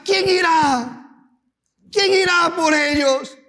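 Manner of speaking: high, loud calling out; voice of God. Acted. God calling for a messenger, loud commanding call.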